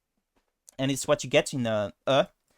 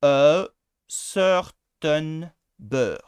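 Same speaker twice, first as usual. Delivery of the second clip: slow, loud French-accented "a certain bird"; French accent, exaggerated; 6 dB louder, much slower than usual. Shown as a demonstration. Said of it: "with a French accent and say".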